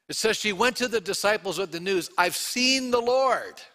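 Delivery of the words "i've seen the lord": high, slow, thrilled announcement; excited Mary. Retold or imitated. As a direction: burst out with the news of seeing the Lord. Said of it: "she's pretty excited".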